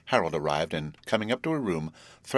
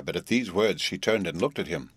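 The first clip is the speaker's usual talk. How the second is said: usual speaking voice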